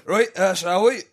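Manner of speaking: put-on Scottish guard accent. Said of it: "I'm gonna talk a lot to this guy".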